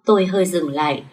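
usual speaking voice

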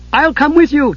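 high, eager kender call; a light, high eager kender voice; eager cry. Acted. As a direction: jump in keen to help. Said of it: "Tass cried".

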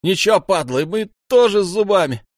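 high, loud defiant shout; furious yelling. A narrator's reading. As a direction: yell a snarling challenge at the enemy. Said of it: "орёт Лёнька".

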